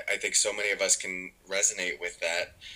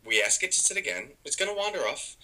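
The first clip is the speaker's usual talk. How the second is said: ordinary talk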